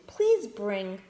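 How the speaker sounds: plain reading voice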